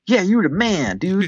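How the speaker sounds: high-pitched mock Southern cheer; Southern townsfolk. Townsfolk clamoring back to the mayor, mock Southern. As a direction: put on a hammy Southern supporter's voice. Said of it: "sound like they're somewhat Southern".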